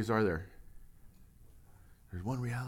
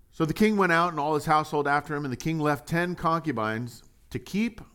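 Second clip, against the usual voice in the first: louder Bible reading, a touch fast; scripture reading; 5 semitones higher, 4 dB louder than usual. Acted. Preacher to church, reading the passage aloud.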